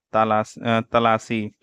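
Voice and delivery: usual speaking voice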